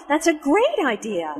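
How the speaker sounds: keen agreement punching "great"; emphasis on GREAT. Acted. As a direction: lean hard on "great". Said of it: "Notice the emphasis on great".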